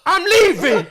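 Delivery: imitated high, loud distressed wail; crying and stressed. Stressed roommate announcing he's going, imitated tearful high cry. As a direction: mimic a friend's tearful outburst. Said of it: "he's crying and he's stressed".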